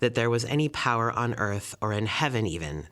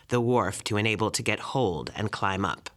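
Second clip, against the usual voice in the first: plain reading voice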